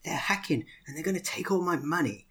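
put-on high-pitched panicky child voice; mock panicked kid. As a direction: imitate a frightened little girl. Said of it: "she was really freaked out".

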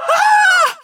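high, loud terrified scream. Acted. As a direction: let out a piercing scream. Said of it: "I screamed inside my own head".

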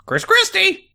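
very high, squeaky parrot voice; cartoon bird screech; talking bird. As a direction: squeak the name like a cockatoo. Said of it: "most birds are like".

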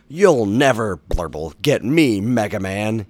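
loud, high cartoon villain taunt. A narrator's reading. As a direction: crow at the hero as a bubbly villain. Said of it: "Bubble Man laughed".

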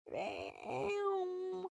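high, drawn-out "damn"; mock wail at a stinging comeback; stung reaction.

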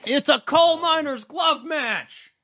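high, drawn-out mock announcement yell. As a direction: yell it out high, stretching the words. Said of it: "what we're yelling about".

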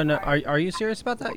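usual speaking voice

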